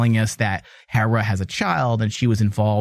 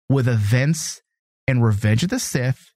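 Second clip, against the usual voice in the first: ordinary talk, nothing marked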